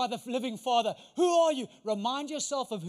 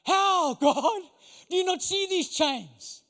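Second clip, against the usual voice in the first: exasperated protest; 5 semitones higher, 4 dB louder, much slower than usual. Retold or imitated. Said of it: "if I was Peter".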